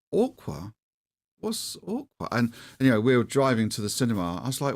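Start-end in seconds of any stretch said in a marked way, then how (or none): 0.1-2.3 high, baffled "Aqua? What's Aqua?" with a quieter last question; mock confusion, pitched up high; puzzled repeat. Puzzled by son's word.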